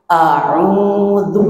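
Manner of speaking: A'udzu with the mouth wide open on the first A; open-mouth demo. Shown as a demonstration. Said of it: "mulut kita terbuka".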